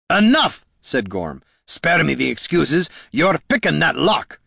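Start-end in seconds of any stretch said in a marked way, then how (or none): 0.1-0.7 angry shout. Acted. Gorm cuts him off. 1.7-4.4 stern gruff order. Acted. Gorm orders.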